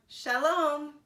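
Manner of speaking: high, loud farewell; woman's goodbye. A narrator's reading. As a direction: wave them off.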